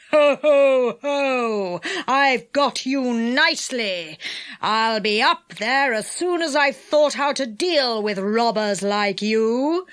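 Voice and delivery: slow villain's gloat with mock laugh; gloating enchanter. A narrator's reading. Enchanter calls up to his trapped prisoners, gloating. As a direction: gloat over your trapped captives.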